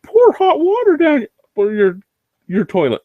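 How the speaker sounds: mocking internet advice. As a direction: parrot a stupid tip in a silly high voice. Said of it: "every stupid internet idea out there".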